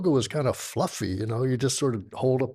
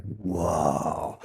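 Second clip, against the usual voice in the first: low awed whoa; 6 semitones lower, much slower than usual. Retold or imitated. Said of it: "And I was like".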